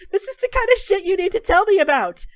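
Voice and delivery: high, loud, outraged yell; furious shout. Acted. Dorian shouting at his friend May for hiding her illness, furious yell.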